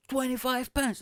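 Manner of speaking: high, excited mock shout from the crowd; imitated bidder's yell. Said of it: "somebody yelled".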